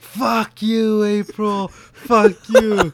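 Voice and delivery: loud, high-pitched furious yell; acted angry shouting. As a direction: yell it like a blazing marital row. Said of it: "they think about the shouting match".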